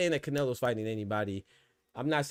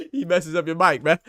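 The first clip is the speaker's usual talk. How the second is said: high, loud amused teasing; laugh, then tease loudly; 5 semitones higher, 10 dB louder than usual. Real, not acted. Teasing a guest about his broken mic, laughing loudly.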